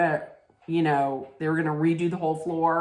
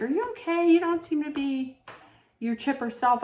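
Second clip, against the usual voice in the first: higher, gentle, worried questioning; concerned coworker; 9 semitones higher than usual. Retold or imitated. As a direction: imitate a caring coworker checking in. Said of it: "she was like".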